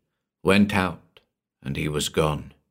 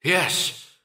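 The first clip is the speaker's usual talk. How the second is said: almost sobbed; 11 semitones higher than usual. Acted. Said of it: "I almost sobbed".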